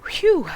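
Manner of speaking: loud high relieved phew. Real, not acted. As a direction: let out a big relief sigh. Relief after surviving roll.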